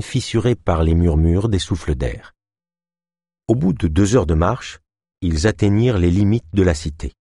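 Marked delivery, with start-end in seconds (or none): none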